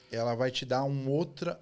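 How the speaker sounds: normal talking voice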